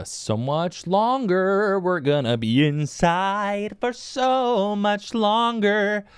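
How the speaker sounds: high, very drawn-out whine; hammed-up despair, rising high and slow; mock wailing. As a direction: stretch it into a theatrical lament. Mock wail about endless quarantine.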